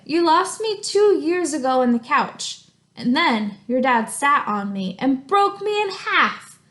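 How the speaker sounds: reading a letter aloud in a lively voice; story reading. Acted. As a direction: read the crayon's letter expressively to the class. Teacher reads crayon letter aloud.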